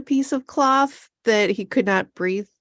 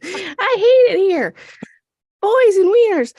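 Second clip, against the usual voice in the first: mock whiny complaint; 12 semitones higher, 4 dB louder than usual. Mocking Mickey stuck with monks, high whiny. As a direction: whine it like a spoiled girl.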